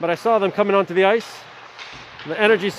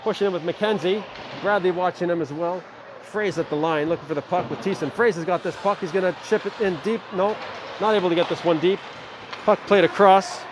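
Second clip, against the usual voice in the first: quick, lively game call; live play-by-play. Acted. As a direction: follow the puck as you call it.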